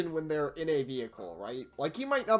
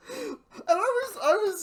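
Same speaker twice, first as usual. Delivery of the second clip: laughing; 8 dB louder than usual. Real, not acted. Laughing cry.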